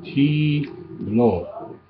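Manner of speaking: Tiblo, aspirated t, slow falling tone; Tiblo as taught. Shown as a demonstration. Teacher showing how he says tiblo. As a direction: say Tiblo carefully with a breathy T. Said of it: "So that's how I say tiblo".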